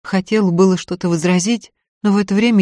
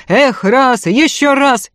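belted dance-song cry, higher and hearty; bass shout; 7 semitones higher, 4 dB louder, slower than usual. Acted. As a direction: whoop it out like a drunk dancer. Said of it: "басила".

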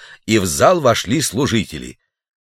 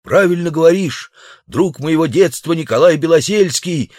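glad exclamation; 7 semitones higher than usual. A narrator's reading. Savage calling to his childhood friend, high eager cry. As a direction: call out in relief to an old friend. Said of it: "воскликнул дикарь".

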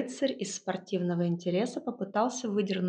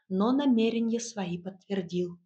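ordinary delivery, nothing marked

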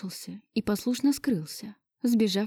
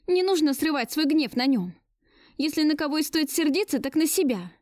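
higher-pitched, mildly heated reproach; indignant rebuke; 8 semitones higher than usual. A narrator's reading. Woman defending a boy from his father, indignant. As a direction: scold him in a raised pitch.

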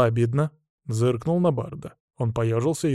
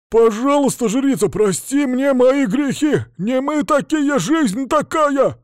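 high, slow pleading wail; hammed-up pleading bandit; begging bandit; 13 semitones higher, 7 dB louder, slower than usual. A narrator's reading. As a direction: grovel for mercy, high and drawn out. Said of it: "Немедленно взмолился он".

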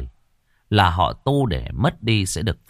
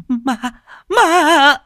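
put-on high, loud terrified shriek of kids; piercing cry of scared kids; children screaming; 9 dB louder than usual. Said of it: "Tiếng lũ trẻ hét lên".

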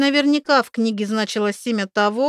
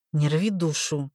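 pained; 4 semitones lower than usual. Acted. Makar pained.

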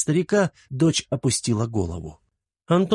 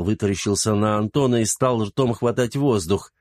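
ordinary delivery

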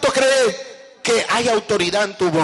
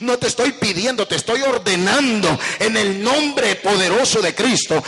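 commanding rebuke. Shown as a demonstration. Believer rebuking Satan out of house, rising commanding shout. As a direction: order the devil out, growing in force. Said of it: "se le habla con autoridad".

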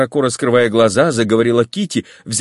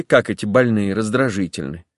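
plain reading voice

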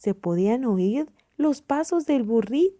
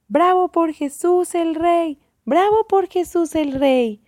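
loud, high cheering; crowd shouting; 7 semitones higher, 5 dB louder than usual. A narrator's reading. Crowd hailing Jesus as king, shouting. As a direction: cheer loudly like a parade crowd. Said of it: "se escuchaba gritar a la gente".

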